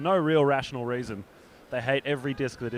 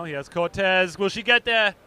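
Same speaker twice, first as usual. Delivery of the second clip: rising excited call, much higher and louder; 6 semitones higher, 6 dB louder than usual. Real, not acted. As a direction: build the suspense as the disc flies. Calling a long throw.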